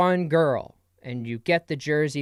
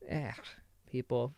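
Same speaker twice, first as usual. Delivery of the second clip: ordinary talk, nothing marked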